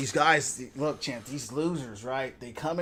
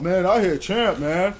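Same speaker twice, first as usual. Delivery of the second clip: mock bragger; 3 semitones higher, 7 dB louder than usual. Mocking the teammate who keeps bragging about champ, loud. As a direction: imitate the boastful teammate.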